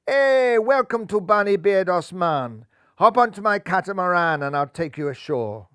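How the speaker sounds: lightly voiced Caribbean welcome, loud opening; a hint of a cheerful Caribbean host; Caribbean accent. Viv greets bunnies, Caribbean voice.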